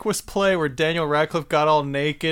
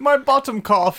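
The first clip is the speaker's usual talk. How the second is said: mock English fluster; 7 semitones higher, 3 dB louder than usual. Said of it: "their expression of bottom cough".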